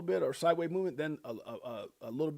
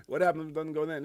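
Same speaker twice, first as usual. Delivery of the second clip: normal talking voice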